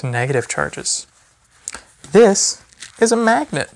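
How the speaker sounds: sing-song picture-book reading to a little one; gentle, lively storybook voice for a toddler. Acted. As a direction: read it like bedtime story time for a baby. Reads a baby book aloud.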